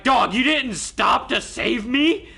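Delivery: mock-wounded disbelief at a friend; loud slow mock betrayal; incredulous outrage. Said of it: "I'm like".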